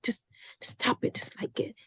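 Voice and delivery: soft unvoiced whisper. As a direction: whisper it like a little secret. Teasing aside to listeners.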